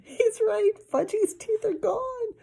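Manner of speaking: put-on high, loud panicked girl; screaming girl. A narrator's reading. Girl crying out at the toddler's missing teeth. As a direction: cry out in horror. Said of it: "Sheila screamed".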